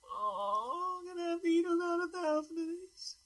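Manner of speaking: high, slow, crying whine; whiny voice. Pretend. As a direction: whimper it out like a spoiled kid. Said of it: "I just did that whiny voice".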